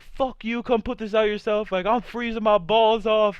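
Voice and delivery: high, loud, angry yell back; dad yelling back. Retold or imitated. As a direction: yell it back at them, furious and freezing. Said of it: "my dad's just yelling back".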